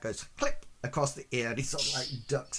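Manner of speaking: ordinary talk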